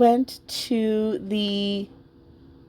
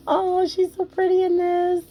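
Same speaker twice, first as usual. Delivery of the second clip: delighted gush; 9 semitones higher than usual. Real, not acted. Admiring the dressed doll.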